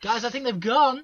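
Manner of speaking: high, loud mock report to the lads; loud comic raider voice; calling to companions. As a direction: call back to your mates, pitched high. Raider calls back to companions.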